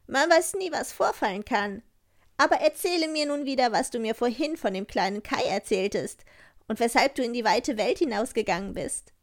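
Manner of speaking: hoch und forsch, ein freches Räuberkind; wildes Räubermädchen. Acted. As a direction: keck und herrisch zu Gerda, höher als der Erzähler. Räubermädchen spricht zu Gerda. (Räubermädchen redet forsch mit Gerda)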